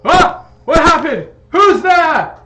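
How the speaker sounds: high, loud startled shout; startled awake. Acted. Zebediah wakes to shattering glass, startled yelling. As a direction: cry out in alarm, jolted from sleep. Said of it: "you hear, uh, Zebediah wake up".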